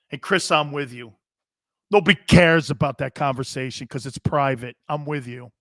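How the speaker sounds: usual speaking voice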